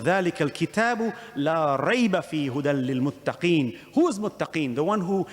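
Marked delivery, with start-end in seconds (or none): 0.0-3.8 fast chanted Quran recitation; Quranic recitation. Real, not acted. Preacher quoting Allah to his congregation, fast chanted recitation. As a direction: chant the verse quickly, in one sweep.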